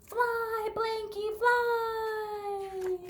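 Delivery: high excited cry; a touch of a boy's cry. Acted. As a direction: call out like the boy. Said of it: "Sam roared aloud".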